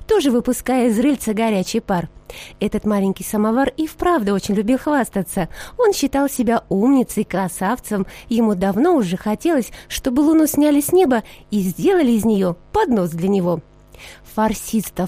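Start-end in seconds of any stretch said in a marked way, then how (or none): none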